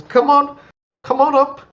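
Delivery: high, loud call down the stairs; calling from upstairs. Acted. Halfling butler calling guests upstairs, high and loud.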